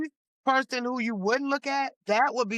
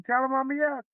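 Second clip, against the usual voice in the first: quoting late husband; 3 semitones higher, faster than usual. Retold or imitated. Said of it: "He'd say".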